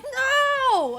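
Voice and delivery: very high, loud "No!"; shrill mock shout. Roommate protesting, high loud shout. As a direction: shriek the no in protest. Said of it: "And he's like".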